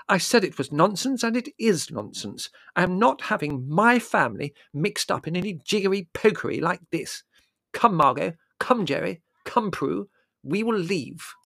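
high, annoyed, emphatic scolding; determined. A narrator's reading. Annoyed Mother ordering her family out, determined. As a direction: lay down the law indignantly. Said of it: "this display of determination".

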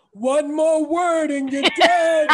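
slow, loud, breathy threat; breathy menacing voice. Retold or imitated. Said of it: "it was that breathy voice".

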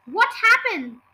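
high, loud startled question; alarmed question. A narrator's reading. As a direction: demand to know, alarmed. Frightened character demands an answer.

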